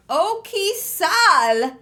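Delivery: loud, high, drawn-out gift shout-out; whooping, stretched-out announcement; excited cheering. Real, not acted. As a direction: sing the donor's name out in celebration. Cheering a big gift from viewer.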